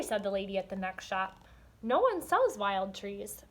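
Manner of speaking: lively storybook reading with voiced characters; story read aloud. Teacher reading a picture book to young students, animated. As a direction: read it to the class with expression.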